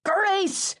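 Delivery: mother's exasperated shout. Retold or imitated. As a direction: yell it to end the argument. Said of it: "my mother shouted".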